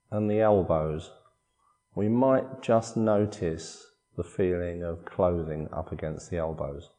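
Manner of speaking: soft, unhurried body-scan voice with long pauses; guided meditation. Acted. Meditation teacher guiding listeners through a body scan, calm guided meditation. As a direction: calmly guide them through relaxing, phrase by phrase.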